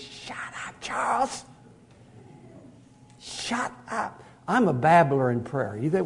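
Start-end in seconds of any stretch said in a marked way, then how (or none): 0.0-4.2 whispered, hushed order to be quiet; hushed command. Acted. God telling the preacher to stop babbling, whispered. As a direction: hush him like God silencing a babbler. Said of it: "he says".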